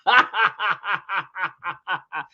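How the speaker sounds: long staccato derisive laugh; sustained mock laughter. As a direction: draw out a scoffing ha-ha-ha. Scoffs at the other suspects.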